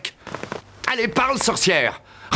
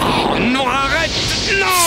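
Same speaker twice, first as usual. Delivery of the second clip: higher and higher panicked cry; 9 semitones higher, slower than usual. Acted. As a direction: beg her to stop, rising to a yell. Soldier attacked by witch.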